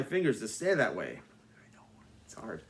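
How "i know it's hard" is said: hushed aside; whispered confidential aside. Acted. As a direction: drop to a whisper.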